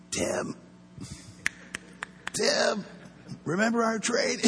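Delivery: high, strained call while being choked; pleading with a partner while held by the throat; choked plea. Retold or imitated. Choked against wall, pleading.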